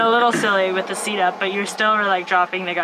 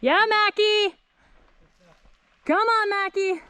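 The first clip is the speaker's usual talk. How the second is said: high-pitched cheer for a runner; hoarse marathon cheering; 13 semitones higher, slower than usual. Real, not acted. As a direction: call out a friend's name to push her. Cheering Mackie at finish.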